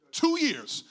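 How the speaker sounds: "Two years" punched high and loud; emphatic outcry. Real, not acted. Preacher stressing his long struggle to church, "Two years" cried out high. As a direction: exclaim "Two years" sharply after a pause.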